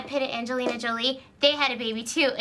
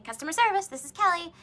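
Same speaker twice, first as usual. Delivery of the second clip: phone greeting; 6 semitones higher, faster than usual. Shown as a demonstration. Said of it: "pick up the phone and say".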